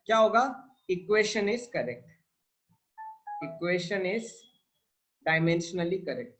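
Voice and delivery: slow, spelled-out dictation for notes. Shown as a demonstration. Teacher dictating to students, slow and deliberate. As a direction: say each word slowly so students can write it down.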